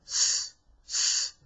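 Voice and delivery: "s, s" as two short voiceless hisses; hissing sound. Shown as a demonstration. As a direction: hiss "s, s" without voice. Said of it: "a sort of buzzing or hissing noise".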